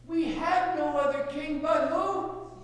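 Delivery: loud, high crowd cry; crowd's chorus. Retold or imitated. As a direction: proclaim it loudly like a chanting crowd. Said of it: "they all said in chorus".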